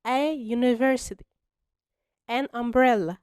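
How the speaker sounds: slowly, each sound separated; slow clear model. Shown as a demonstration. Teacher modelling the answer for students, slow and clear. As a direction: spell it out for beginners. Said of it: "فهنقول".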